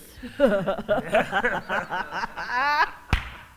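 long, loud laugh; scoffing laugh. Shown as a demonstration. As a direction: laugh it off loudly as your rebuttal. Laughter as rebuttal to doubters' lines.

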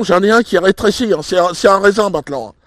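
normal talking voice

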